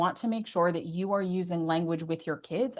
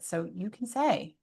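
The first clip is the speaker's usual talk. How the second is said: normal talking voice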